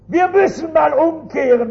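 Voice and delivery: urgent appeal. Acted. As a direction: rouse the congregation. Preacher's appeal to audience. (dringender Aufruf, höher und lauter)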